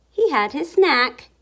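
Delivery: plain reading voice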